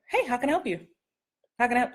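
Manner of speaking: a friendly clerk greeting a customer; casual friendly. Shown as a demonstration. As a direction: toss it off like a store clerk. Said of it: "they would say".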